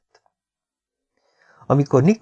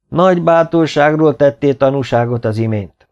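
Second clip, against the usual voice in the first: raised, higher voice for all to hear; loud public praise; 5 semitones higher, 6 dB louder than usual. A narrator's reading. King praises Nikolá before everyone, loudly proclaiming. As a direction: proclaim it to the whole hall. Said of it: "olyan hangon, hogy mindenki hallhatta".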